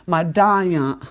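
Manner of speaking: "Madaya" with the middle "da" stressed and stretched; second-syllable emphasis. Shown as a demonstration. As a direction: lean on the "da" in "Madaya". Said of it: "emphasis on the second syllable".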